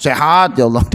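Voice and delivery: mocking a casual, low-voiced prayer; imitated offhand praying, low and unpleading; arrogant prayer. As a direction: toss off the requests low, dropping away on God's name. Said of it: "donga kita sombong".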